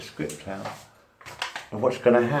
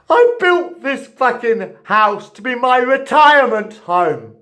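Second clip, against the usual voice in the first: loud, much higher, slow raging yell; furious shout; 9 semitones higher, 12 dB louder, slower than usual. Real, not acted. Tenant raging at the housing company over his flat, furious shouting. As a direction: scream the grievance out at them. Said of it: "Sorry, people. Lost my temper".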